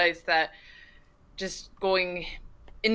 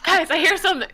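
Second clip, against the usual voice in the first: excited alert; 11 semitones higher, 5 dB louder than usual. Acted. Character alarmed, laughing.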